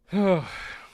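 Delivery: long exasperated sigh rising then falling; a real tired sigh; weary sigh. Real, not acted. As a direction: let out a fed-up breath. Exasperated sigh after ranting.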